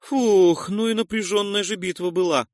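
high character voice, loud opening sigh of relief; drawn-out relief. A narrator's reading. Noise to his comrades after the battle, drawn-out relief. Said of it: "протянул Нойс".

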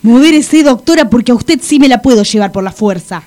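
loud, rapid warning; advertencia airada. Acted. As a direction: rattle off an angry threat at full voice. Amenaza con llevársela por la fuerza. (amenaza airada, fuerte y rápida)

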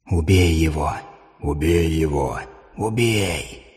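low, soft, whispered voices in the mind; whispering voices. A narrator's reading. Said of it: "шептали в сознании ... голоса".

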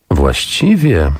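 very slow, pondering word; drawn-out musing; thoughtful. Acted. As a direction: stretch it out while thinking. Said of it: "powiedziała z namysłem".

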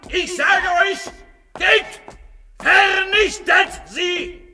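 high, loud, slow shouted harangue; fanatic oration. Acted. Would-be Führer sending his followers to destroy, frenzied. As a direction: rant like a demagogue at a rally.

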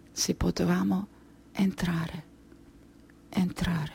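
almost inaudible, repeating to herself; fading to a murmur. Acted. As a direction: let the words die out like a memory. Remembers begging at doors at night.

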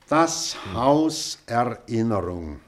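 slow "Das Haus Erinnerung", each word set apart and weighted; measured, deliberate announcement of "Das Haus Erinnerung"; emphatic title. Acted.

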